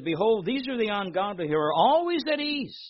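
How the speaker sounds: loud, declaiming psalm reading; preacher reading the psalm aloud; scripture reading. Acted. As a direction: proclaim the verses to the congregation.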